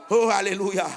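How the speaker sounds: ordinary talk, nothing marked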